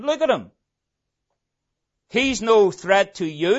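normal talking voice

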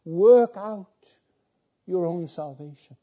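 the verse rung out loud and high on "Work out"; preacher booming the command to his flock; proclaimed. Acted. Preacher proclaiming the verse loud.

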